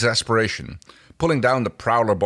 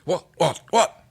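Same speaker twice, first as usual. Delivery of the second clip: quick, rising, higher "what, what, what"; impatient pestering; 9 semitones higher, 3 dB louder, slower than usual. A narrator's reading. John pestering Rigel impatiently, higher and rising. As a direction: badger him, rising to a squeak. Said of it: "pestered John impatiently".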